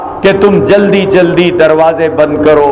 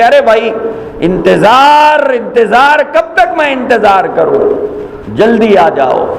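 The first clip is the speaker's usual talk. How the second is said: impatient calling; 8 semitones higher than usual. Retold or imitated.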